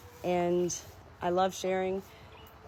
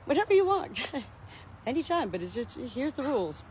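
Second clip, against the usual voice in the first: much higher, breezy and generous; high-pitched, good-natured girl talk; sassy friendly lady; 6 semitones higher than usual. Retold or imitated. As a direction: play the chicken dealer as easygoing and sassy. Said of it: "She was like".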